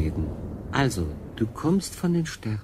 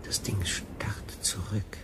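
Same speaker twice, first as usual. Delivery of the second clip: fearful whisper; 4 semitones lower, 10 dB quieter, much slower than usual. Acted. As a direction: hush it, slow and afraid. Unheimliches Ding im Dunkeln. (ängstlich geflüstert)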